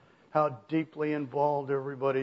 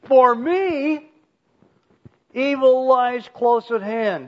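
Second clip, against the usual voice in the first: "For me" much higher and louder; emphatic; 7 semitones higher, 7 dB louder, slower than usual. Acted. Preacher reading Paul's added word, emphatic. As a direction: punch "For me", then carry on forcefully. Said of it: "here's another word of emphasis".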